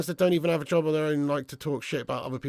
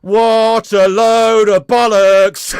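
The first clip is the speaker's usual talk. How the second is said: loud, slow, drawn-out scoff; a fan who can't believe what he just read, roaring it slowly; incredulous shout; 4 semitones higher, 10 dB louder, much slower than usual. Real, not acted. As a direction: bellow it out in disbelief, dragging each word. Reacting to a chat comment.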